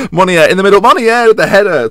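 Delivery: loud, high-pitched excited commentary; excited goal call. Real, not acted. Host calling Monier's headed goal, excited shout. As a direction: shout the header home, voice leaping up.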